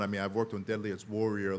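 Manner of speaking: ordinary delivery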